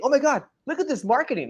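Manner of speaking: high excited gasp; excited buddy. Retold or imitated. Friend showing off flyer, high and excited. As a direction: mimic a thrilled friend showing off his phone. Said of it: "he was so excited".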